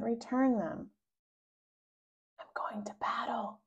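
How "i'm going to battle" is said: hushed brave whisper. A narrator's reading. Putting on his cape, whispering to Mary. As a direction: whisper it like a secret mission. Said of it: "I tell her".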